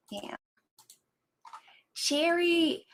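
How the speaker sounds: usual speaking voice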